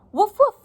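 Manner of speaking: high, loud spoken woofs; startled dog woof. A narrator's reading. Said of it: "said the startled dog".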